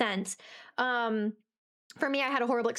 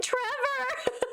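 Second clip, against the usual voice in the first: high, drawn-out cry for help; playful wail of a name; mock panicked call; 13 semitones higher than usual. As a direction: call out for a partner in hammed-up distress. Acting out panicked call to partner.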